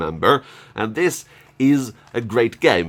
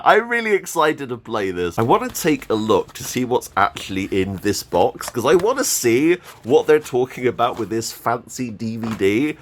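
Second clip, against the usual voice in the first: ordinary talk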